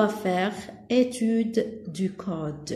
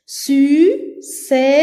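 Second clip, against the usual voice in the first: louder "Sucette", split su, ce, tte; syllable by syllable; 3 semitones higher, 6 dB louder than usual. Shown as a demonstration. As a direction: separate all three syllables of "Sucette". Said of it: "on a trois syllabes". (« Sucette » découpé en trois syllabes)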